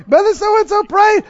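mock upset believer whining; mock whiny complaint. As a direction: complain like a let-down churchgoer. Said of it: "start crying and get all upset".

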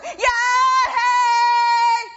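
loud, high jeering yell; rowdy mocking shout; devil's shout. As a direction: heckle like the devil. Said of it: "And the devil will go".

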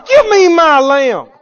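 full-force high holler, words stretched; fierce demand. Acted. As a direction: roar it out high, demanding it back. Acting David attacking the lion.

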